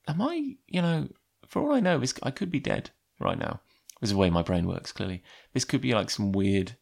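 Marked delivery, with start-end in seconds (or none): 0.1-3.6 dreamy wondering thought. Retold or imitated. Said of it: "and you're like".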